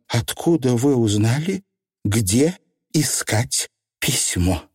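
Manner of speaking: cold, measured questioning, slowing down; each word set apart, icily; icy tone. A narrator's reading. Young witch interrogating the Feegles, icy. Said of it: "спросила ледяным тоном".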